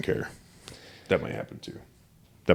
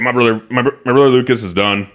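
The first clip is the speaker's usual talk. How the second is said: lightly imitated higher-pitched voice; bad Botkin voice; 6 semitones higher, 4 dB louder than usual. Podcaster retelling Isaac's video statement, lightly imitating him. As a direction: lightly mimic someone announcing it, pitched up. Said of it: "Botkin voice".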